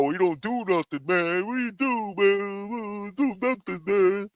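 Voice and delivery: high mocking whine of the critics; sneering impression of lazy-calling critics; mocking the haters. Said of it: "Tell me I'm fucking lazy".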